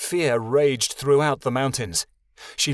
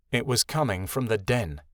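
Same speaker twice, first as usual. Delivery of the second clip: usual speaking voice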